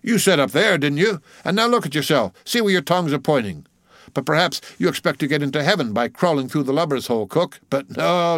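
tease, then rap out orders; bossy mate. Acted. Mate giving old cook his orders, bossy.

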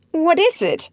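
very high, insistent question; frightened boy crying out high; alarmed cry. A narrator's reading. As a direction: demand it in fright. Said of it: "he cried again".

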